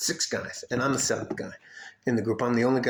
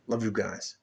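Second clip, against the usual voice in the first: ordinary delivery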